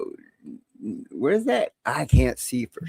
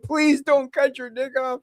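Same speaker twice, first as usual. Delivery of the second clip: higher, drawn-out mock plea; retold pleading; 8 semitones higher, slower than usual. Retelling a partner's plea before surgery, high mock pleading. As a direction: beg slowly in an imitating high voice.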